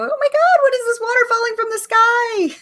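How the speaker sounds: high, loud mock wonder; amazed. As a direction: gasp at the sky in wonder. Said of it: "everyone goes to the window and go".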